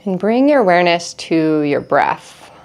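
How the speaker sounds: calm, measured meditation guide with long pauses; guided meditation. Acted. Teacher leads listeners through breathing in an energy cleanse, calm guided meditation. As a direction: guide them gently through each breath, unhurried.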